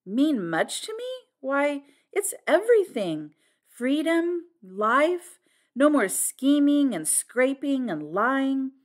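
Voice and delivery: high, emphatic and drawn-out words; impassioned outburst. A narrator's reading. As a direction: ring out each word of newfound freedom. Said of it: "and then Flora burst out".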